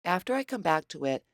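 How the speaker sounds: normal talking voice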